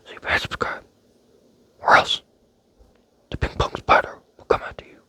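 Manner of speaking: ordinary delivery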